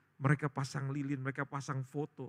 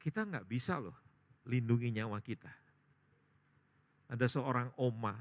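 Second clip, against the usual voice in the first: ordinary delivery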